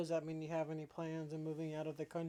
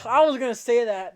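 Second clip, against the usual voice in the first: much louder, higher outburst; loud protest; 7 semitones higher, 14 dB louder than usual. Real, not acted. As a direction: burst out at being beaten to the joke. Friend beat them to the joke.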